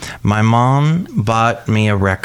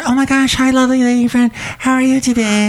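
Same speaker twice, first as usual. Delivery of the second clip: high sing-song shop lady; Shelley Shuhart voice; 14 semitones higher than usual. Acted. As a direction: gush in a high character voice. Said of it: "It's Shelley Shuhart".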